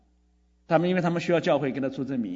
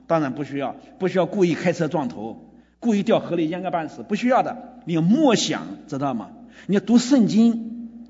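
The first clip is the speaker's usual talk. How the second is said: louder, rising sermon exhortation; preaching at full force; 3 semitones higher, 6 dB louder than usual. Acted. Pastor urging the congregation, preaching at full force. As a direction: press the point home to the congregation.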